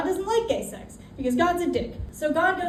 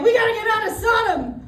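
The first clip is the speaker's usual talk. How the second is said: much higher, alarmed; panicked Lot; 9 semitones higher, 5 dB louder, faster than usual. Retold or imitated. Lot to his family after slamming the door, panicked and high.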